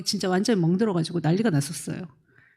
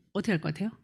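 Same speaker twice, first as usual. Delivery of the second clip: usual speaking voice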